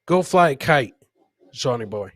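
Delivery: ordinary delivery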